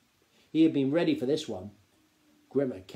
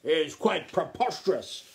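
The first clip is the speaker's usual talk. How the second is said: loud, high, indignant protest; blustering aristocrat, louder and higher; snapping duke; 7 semitones higher, 7 dB louder than usual. A narrator's reading. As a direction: scoff at the whole idea. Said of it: "snapped the Duc de Haberdashery".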